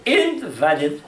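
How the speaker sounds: "Invalid" as IN-valid, front-stressed. Shown as a demonstration. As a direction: hit IN hard in "Invalid". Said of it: "the accent can change".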